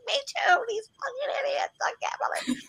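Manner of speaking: very high squeaky mock rage; retold outrage squeezed into a squeal; mad, tearful ranting. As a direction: whine it furiously in a tiny high voice. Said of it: "I was, like, so mad".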